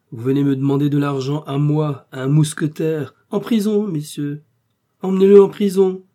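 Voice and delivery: loud, high mock outrage; blustering indignation building to a shout; indignant. A narrator's reading. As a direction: rage at his impudence and order him jailed. Feigned outrage at the creditor.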